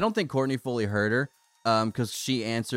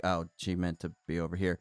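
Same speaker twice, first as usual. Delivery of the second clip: hushed, knowing aside under the breath; soft, knowing murmur as the stranger walks up; quiet knowing aside; 7 semitones lower, 8 dB quieter than usual. Retold or imitated. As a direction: say it softly to yourself, amused. Realizing the neighbor's mistake.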